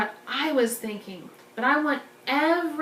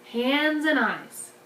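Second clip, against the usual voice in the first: usual speaking voice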